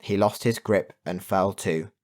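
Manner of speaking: plain reading voice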